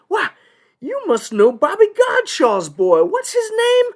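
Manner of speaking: put-on high, loud, eager good old boy; excited judge. Judge asks the Newman graduate about a classmate, excitedly. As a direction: pounce delightedly on a shared connection.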